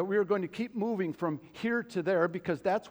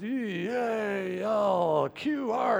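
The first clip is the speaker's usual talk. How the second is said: put-on very slow, drawn-out drunken spelling; drunk fan cheer; 3 semitones higher, much slower than usual. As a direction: slur through a garbled cheer, stretching letters. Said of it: "you've had too much to drink".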